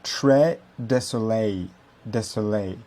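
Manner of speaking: slow American-accented très désolé, R dropped; American accent in French. Shown as a demonstration. Teacher imitates an American saying très désolé slowly. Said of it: "Bien sûr, le E, très".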